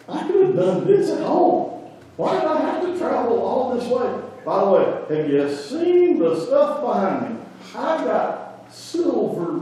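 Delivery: high, boastful, affronted general; haughty complaint. Retold or imitated. Preacher voicing Naaman bragging of his riches, haughty and high. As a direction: puff up and brag about the riches. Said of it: "He's mad and angry".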